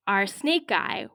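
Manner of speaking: usual speaking voice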